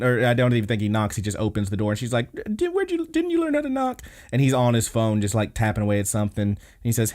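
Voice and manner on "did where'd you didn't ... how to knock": very high mock-annoyed girl; flustered indignation. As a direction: stammer a high-pitched scolding. Girl scolding brother who barged in.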